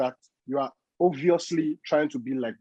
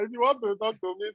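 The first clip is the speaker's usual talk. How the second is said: higher, playful swagger voice; put-on tough voice; 9 semitones higher, 4 dB quieter than usual. Teasing Ada to speak boldly, higher swagger voice. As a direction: challenge her in a higher put-on voice.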